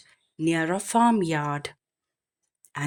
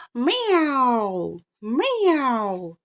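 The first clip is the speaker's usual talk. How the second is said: higher mewing meow; crying cat; 5 semitones higher than usual. Acted. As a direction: mew like a lazy cat. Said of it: "cried the cat".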